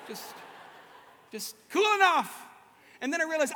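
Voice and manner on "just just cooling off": slow, loud and high, playing it cool; mock casual. Sweating in a hotel doorway, acting mock casual. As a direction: breeze it out like nothing's wrong.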